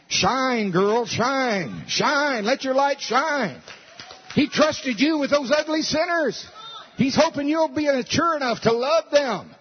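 high, loud preacher's cry, slowing on the shine; rally her like a fired-up preacher; preaching at full force. Acted. Preaching reply to believer.

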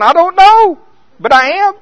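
very high, loud cry of helplessness; exasperated confession. Retold or imitated. Smoker answering scripture, a loud, high helpless cry. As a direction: wail it out, caught and ashamed.

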